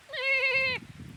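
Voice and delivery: short high laugh; high burst of laughter. Real, not acted. Laughing at the sheep, high burst of laughter. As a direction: crack up laughing.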